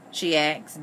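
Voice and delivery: ordinary delivery, nothing marked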